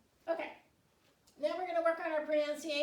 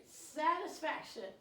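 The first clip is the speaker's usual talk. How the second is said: slow, clear word; 3 semitones higher, much slower than usual. Shown as a demonstration.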